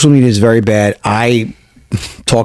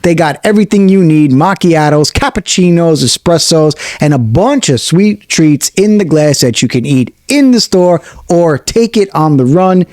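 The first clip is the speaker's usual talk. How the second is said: higher, upbeat radio ad voice; ad read; 4 semitones higher than usual. Acted. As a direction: sell the cafe like a radio spot. Continues the sponsor read.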